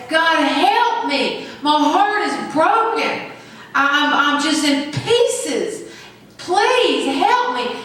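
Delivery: slow, pleading cry to God; pleading prayer. Retold or imitated. Preacher voicing his own prayer in heartbreak, slow and pleading. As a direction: beg God for help, slowly. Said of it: "When I need God".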